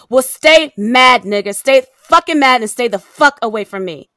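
angry shouted dismissal; furious send-off. Acted. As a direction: hammer each word before hanging up. Final outburst before hanging up.

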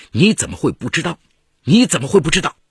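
indignant outburst, rising in force; angry disbelief. A narrator's reading. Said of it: "金杰气得跳了起来， 连声说".

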